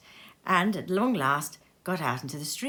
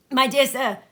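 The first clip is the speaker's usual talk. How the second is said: eager greeting; 5 semitones higher, 6 dB louder than usual. A narrator's reading. Scrooge greeting warmly.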